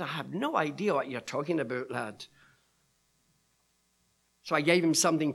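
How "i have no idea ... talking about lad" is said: low, soft imitation of a Scotsman; a hint of Scottish, quiet and falling away; Scottish accent. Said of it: "with his Scottish accent".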